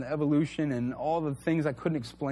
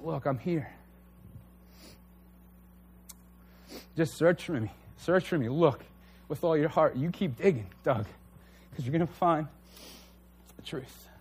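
tender reassurance. Pretend. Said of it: "he just said".

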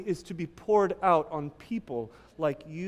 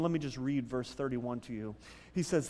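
ordinary delivery

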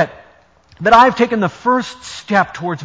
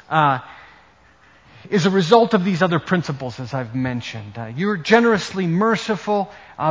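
usual speaking voice

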